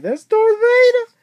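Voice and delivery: very high, loud squealed outburst; amused mock exclamation. Viewer retells his laughing reaction to the doll's voice, squealed exclamation. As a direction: blurt it in a comically high voice. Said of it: "I laughed. I was like".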